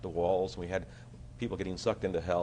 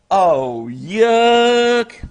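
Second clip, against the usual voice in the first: mock disgust; 18 dB louder than usual. Mock disgust at blown breath.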